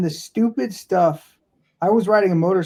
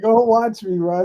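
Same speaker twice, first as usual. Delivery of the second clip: higher show-off taunt; mock cocky bro challenge; mock bravado; 6 semitones higher than usual. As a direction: brag like a gym bro.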